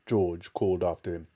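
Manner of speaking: usual speaking voice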